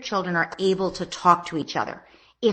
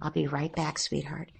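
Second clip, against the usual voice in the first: much softer, murmured reassurance; beneath my breath; 3 semitones lower, 8 dB quieter than usual. Shown as a demonstration. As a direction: barely voice it, tender and close. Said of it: "almost beneath my breath".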